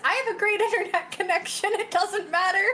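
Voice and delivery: high-pitched insistence breaking into laughter; laughing protest. Retold or imitated. Retelling the reply to joining from Canada, laughing protest.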